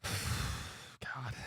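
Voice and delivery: a weary whispered God after a sigh; exasperated whisper under the breath. Real, not acted. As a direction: breathe the word out on a sigh, unvoiced. Sighs after dire health report.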